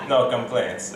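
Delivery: ordinary talk, nothing marked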